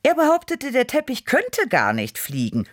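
high, indignant outburst; mock outrage, pitched high; indignant snort. A narrator's reading. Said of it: "schnaubte ich".

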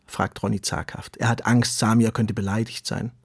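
ordinary delivery, nothing marked

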